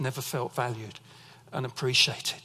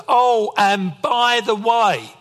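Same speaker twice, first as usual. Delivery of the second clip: slow, drawn-out and louder, higher warning; dad's ominous tone; 6 semitones higher, 10 dB louder, much slower than usual. Retold or imitated. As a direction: stretch "Oh, and by the way" like a dad about to lecture. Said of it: "I've heard that phrase with that tone".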